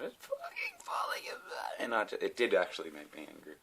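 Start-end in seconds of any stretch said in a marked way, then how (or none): 0.0-1.8 high, slow whispered fury; whispered mock fury. Mocking his girlfriend, high whispered fury. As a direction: hiss it like a fuming girlfriend. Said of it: "she was going".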